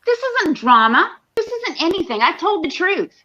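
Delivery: high, strained, exasperated protest; exasperated outburst. Real, not acted. Streamer snapping at chat, high and exasperated. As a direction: flare up, fed up with the whole thing.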